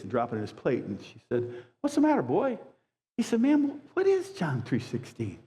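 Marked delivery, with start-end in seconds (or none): 1.8-2.6 lightly voiced concerned old lady; a hint of a worried lady asking a boy; kind lady. Lady questions crying boy. 3.4-5.4 put-on slow, higher little boy asking; a timid child voice, drawn out; lightly voiced boy asking the lady; small boy. Crying boy asks lady.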